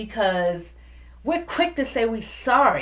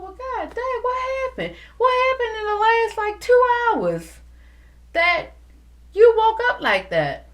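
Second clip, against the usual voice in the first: much higher, mock incredulous wonder; incredulous disbelief; 13 semitones higher, 3 dB louder than usual. Talking to herself about a nasty cashier, high incredulous disbelief. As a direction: play it as if stunned by the cashier's rudeness. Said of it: "And I'm saying to myself".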